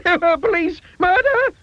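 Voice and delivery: very high, loud panicked cries; screaming for help. A narrator's reading. Mister Jelly under a leaf, screaming for help. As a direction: scream blue murder. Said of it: "he screamed".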